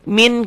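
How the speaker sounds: MIN high, firm final n; clear N. Shown as a demonstration. Teacher pointing out the N sound, clear n. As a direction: punch MIN as spelled. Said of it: "kan bunyi N".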